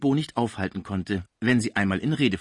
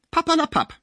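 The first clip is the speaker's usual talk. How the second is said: much higher, brushing it off; dismissive scoff; 5 dB louder than usual. A narrator's reading. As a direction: wave his fear away. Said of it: "meinte die Cibot". (abwinkend, sehr hoch)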